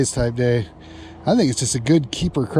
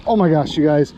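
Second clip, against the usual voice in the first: ordinary talk, nothing marked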